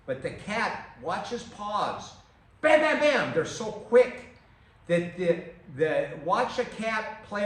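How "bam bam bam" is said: loud, high, rapid hits; punchy strike sounds. Shown as a demonstration. Coach showing a cat's quick strikes, loud and sharp. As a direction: bang out each bam like a quick paw.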